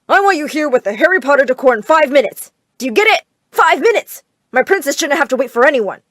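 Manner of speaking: loud, high demanding father on the phone; barking orders. Acted. Father yelling at the decorators on the phone, loud and demanding. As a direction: shout it down the line at the decorators.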